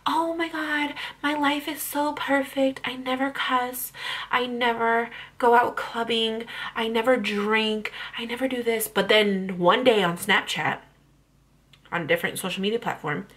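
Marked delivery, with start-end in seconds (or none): 0.1-8.9 higher, slower mock influencer voice; mock perfect influencer. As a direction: play the fake picture-perfect vlogger. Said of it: "I don't have to be like".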